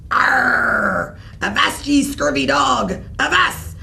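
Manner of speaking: high, loud hammed-up pirate bark; egg-shaped pirate toy bellowing its line. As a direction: belt it like a talking pirate toy. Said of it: "that bellowed".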